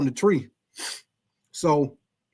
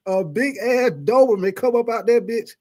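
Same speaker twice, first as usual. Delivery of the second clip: usual speaking voice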